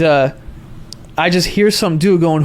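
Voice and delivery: ordinary talk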